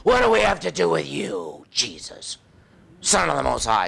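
lightly voiced demon answering Jesus. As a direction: let a demon speak through, sinking away. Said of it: "another voice comes up and says".

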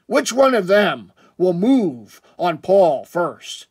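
slow, loud cliffhanger announcer; mock-dramatic announcer. Vlogger hyping friends' race to buy a jersey, mock-dramatic announcer. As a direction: tease the rivalry like a serial narrator, drawn out.

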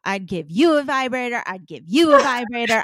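building from a lifted voice to a full, loud showman shout; game-show host handing out prizes, growing to a roar; Oprah-style giveaway. Retold or imitated. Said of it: "I'm like".

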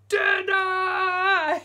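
put-on high, very loud giant-baby shout. Huge baby on the roof shouting for dinner, very loud. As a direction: bellow from the rooftop. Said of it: "he shouted".

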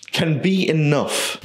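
ordinary delivery

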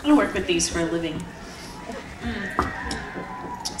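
talking around a mouthful of chocolate; munching chocolate between words; chewing while talking. Real, not acted. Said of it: "Sorry, I'm munching on chocolate".

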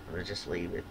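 ordinary talk, nothing marked

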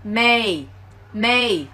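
"may" with the final K swallowed entirely; "may", the wrong way to say make, with no K at all; K dropped. Shown as a demonstration. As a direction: drop the K completely, "may". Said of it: "không có âm K thì các bạn sẽ đọc là may".

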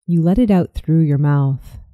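usual speaking voice

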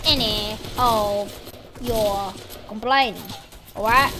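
a hint of a stern father, each word spaced out; mock father telling off his boy; stern scolding. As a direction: lay down the law word by word, lightly voiced.

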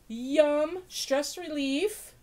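ordinary delivery

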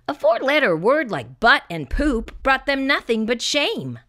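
usual speaking voice

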